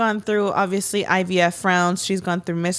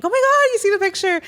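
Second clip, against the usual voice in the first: much higher and louder, a real squealed shout; retold scream; 12 semitones higher, 4 dB louder, faster than usual. Reacting to the baby photo, a high loud squeal. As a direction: scream it like you just saw the photo. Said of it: "you just do is fucking scream".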